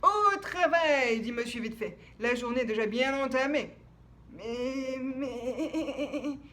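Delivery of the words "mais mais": halting, stammering words. Acted. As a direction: stutter it out. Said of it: "bégaya Monsieur Endormi".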